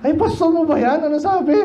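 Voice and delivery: a nosy member sneering at your pastor; gossiping churchgoer. Retold or imitated. Nosy church member mocking a pastor, high scandalized gossip.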